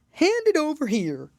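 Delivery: high, loud commanding bird; dodo voice. A narrator's reading. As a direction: demand it as the pompous dodo. Dodo demands thimble.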